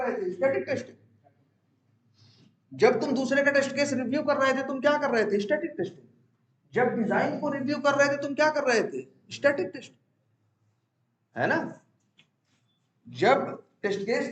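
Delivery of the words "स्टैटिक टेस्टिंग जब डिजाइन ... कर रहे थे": ordinary talk, nothing marked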